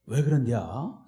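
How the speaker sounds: low-pitched Chungcheong drawl with rising end; Chungcheong dialect. Shown as a demonstration. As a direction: show off your Chungcheong lilt. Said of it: "충청도 말이 나와요". (충청도 사투리 억양)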